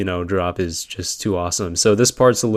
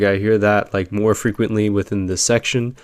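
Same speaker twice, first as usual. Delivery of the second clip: normal talking voice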